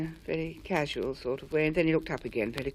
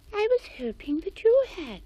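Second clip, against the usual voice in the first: much higher mouse squeak; tiny mouse squeaking hello; squeaky voice; 14 semitones higher, 3 dB louder than usual. A narrator's reading. Millicent Mouse speaks.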